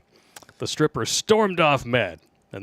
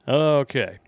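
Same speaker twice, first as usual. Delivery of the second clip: usual speaking voice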